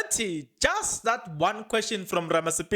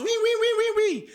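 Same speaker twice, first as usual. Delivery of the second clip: high, loud "wee wee" police siren; mock police siren wail; imitated siren; 12 semitones higher, 3 dB louder than usual. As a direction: mimic the siren on the van.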